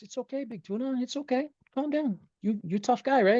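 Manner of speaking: high-pitched coaxing reassurance; calm a big baby down; imitated soothing trainer. Trainer soothes him during clipping.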